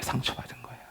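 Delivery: breathy repeat in a whisper, nearly silent. Real, not acted. Pastor whispering the hurt back to the congregation. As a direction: let the word fall away into a hush.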